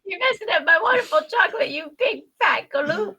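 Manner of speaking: high, whiny hammed-up scolding; mock distress. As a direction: play a wailing, offended chocolatier. Extends the mock Wonka quote.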